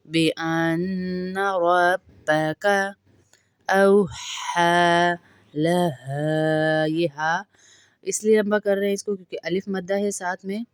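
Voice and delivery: slow, drawn-out melodic tarteel; Quran recitation. Shown as a demonstration. Teacher reciting the verse to students, slow melodic tarteel. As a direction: chant each word slowly and evenly.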